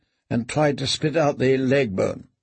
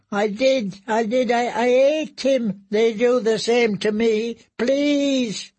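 much higher, slow broken pleading; much higher, slow terrified plea; pitiful frightened confession; 9 semitones higher, slower than usual. Acted. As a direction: justify yourself desperately. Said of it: "he mumbled".

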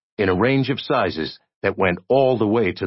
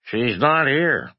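slow, higher put-on voice; higher, stretched-out fake voice; disguised voice; 6 semitones higher, slower than usual. A narrator's reading. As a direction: draw it out in a disguised voice. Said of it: "tried to disguise his voice".

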